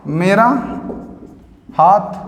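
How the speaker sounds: each word alone, long pauses; slow dictation. Shown as a demonstration. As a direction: dictate for the class to note down. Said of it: "teacher dictates word by word". (रुककर धीमे बोलकर लिखवाना)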